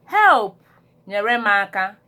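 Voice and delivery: a sudden loud cry of help; shouted call for help. Shown as a demonstration. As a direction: shout it out like you need help now. Calling for help in distress.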